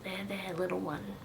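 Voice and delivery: soft, gentle soothing; hushed comfort. Acted. Doctor soothing the crying boy, hushed and soft. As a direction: hush him tenderly. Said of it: "in a hushed tone".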